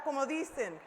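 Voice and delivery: normal talking voice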